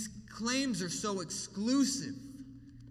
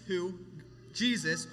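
usual speaking voice

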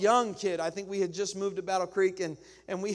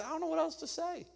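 higher, softer helpless excuse; helpless shrug; 9 semitones higher, 5 dB quieter, faster than usual. Retold or imitated. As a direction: plead innocence to the wife. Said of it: "I say".

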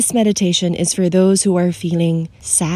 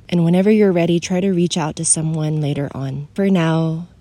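usual speaking voice

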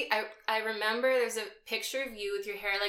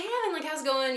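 high friendly greeting; 8 semitones higher, faster than usual. Retold or imitated. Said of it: "greeting an old acquaintance".

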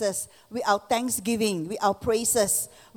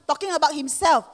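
ordinary talk, nothing marked

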